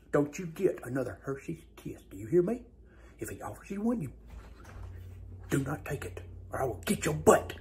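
hushed, breathy warning aside; sneaky whisper. Acted. Dad whispering a threat to his son about candy. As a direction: whisper the threat so grandparents can't hear.

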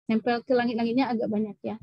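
ordinary talk, nothing marked

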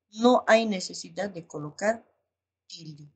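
usual speaking voice

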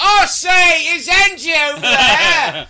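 very high, loud hammed-up holler; mock British rocker. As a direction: call out like an over-the-top rock star. Same mock rocker calling for Angie.

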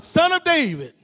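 preacher voicing a beggar's shout. A narrator's reading. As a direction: call out as the blind beggar. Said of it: "he shouted".

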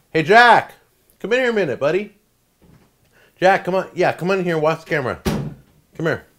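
high, loud call to another room. Real, not acted. Calling son Jack into the kitchen, loud call.

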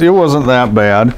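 normal talking voice

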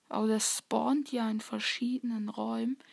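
ordinary talk

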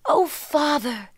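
high, drawn-out appeal to a father; pleading cry. A narrator's reading. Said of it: "she cried".

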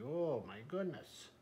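plain reading voice

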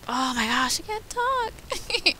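high, drawn-out whine at herself; playful exasperation. Real, not acted. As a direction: wail it slowly, way up high. Tongue-tied, exasperated at herself.

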